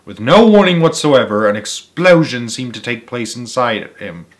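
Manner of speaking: ordinary delivery, nothing marked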